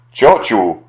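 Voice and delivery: a loud, agitated cry of Ciociu!; agitated shout. A narrator's reading. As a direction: call after the aunt, upset and loud. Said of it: "krzyknął wzburzony Paweł". (wzburzony okrzyk)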